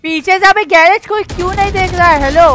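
exasperated yell at the squad; frustrated shout. Real, not acted. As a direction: call it out angrily across the team. Teammates ignoring garage, calling out.

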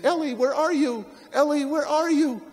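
high anxious calling out for her friend; frantic calling. Retold or imitated. Little daughter calling her missing friend, high and worried. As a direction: call out high and scared, searching.

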